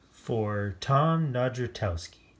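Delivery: usual speaking voice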